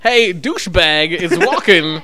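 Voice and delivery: prank-call someone as Walken; Christopher Walken. Pretend. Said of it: "It's actually Christopher Walken".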